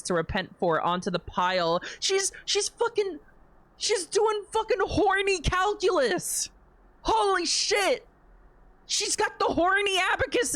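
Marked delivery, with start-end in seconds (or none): 2.0-10.6 very high, drawn-out squeal; excited outburst. Real, not acted. Reader reacting to Hedwig's sin calculations, high excited squeal. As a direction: squeal it in delighted disbelief.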